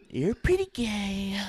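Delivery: high, slow, stretched presidential voice; Donald Trump. Acted. Host teasing co-host as the president, slow impression. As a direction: drawl it as a politician impression. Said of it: "that was good".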